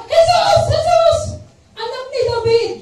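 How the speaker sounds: loud high desperate cry. Retold or imitated. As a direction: shout his name over the crowd. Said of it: "nagsisigaw siya".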